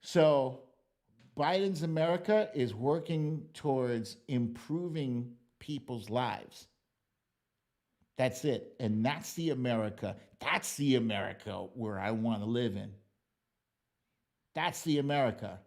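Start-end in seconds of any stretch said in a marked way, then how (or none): none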